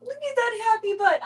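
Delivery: delighted pet voice. Real, not acted. Owner gushing at her kitten's wiggling butt. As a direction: gush over the happy butt.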